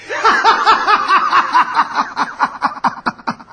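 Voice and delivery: high, loud gleeful cackle; hearty laughter. Acted. Xantipa hiding under the table, laughing in loud glee. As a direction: burst into delighted laughter. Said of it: "soltou uma gostosa gargalhada".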